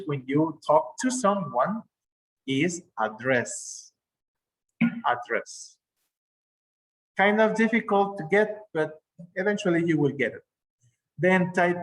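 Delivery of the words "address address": slow it down and lean on the end of address; stress on second syllable. Shown as a demonstration. Teacher models the verb for giving a speech, address stressed on the second syllable. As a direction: address said slowly as ad-DRESS, low. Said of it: "when you want to give a speech".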